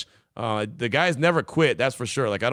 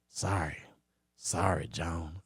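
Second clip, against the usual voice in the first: lower, much softer apology in a lightly voiced Jerry Jones; 8 semitones lower, 10 dB quieter, much slower than usual. Host apologizing to the texter in a light Jerry Jones voice. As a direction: slip back into the Jerry impression for a soft sorry. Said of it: "you're stuck with Jerry Jones".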